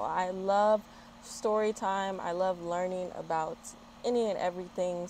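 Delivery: ordinary delivery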